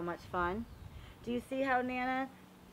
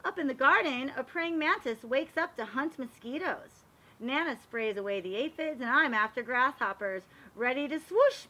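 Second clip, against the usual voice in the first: storybook narration; 2 semitones higher, 6 dB louder than usual. Acted. Teacher reading a garden book aloud to the class, storybook voice. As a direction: read it like a picture book for little kids. Reads picture book to children.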